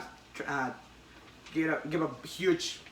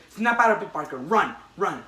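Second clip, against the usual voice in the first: urgent shout; 3 semitones higher, 6 dB louder than usual. Retold or imitated. Retelling the comic, a voice urges Peter to flee. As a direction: push him to run now.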